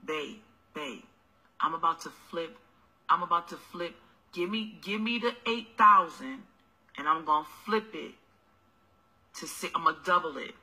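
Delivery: low, soft sweet-talking hustler voice; mock broke boyfriend. Boyfriend begging for money.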